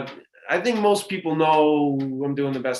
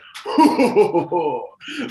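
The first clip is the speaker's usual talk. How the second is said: villain laugh; 4 semitones higher, 5 dB louder than usual. Pretend. Mock villain laugh before line.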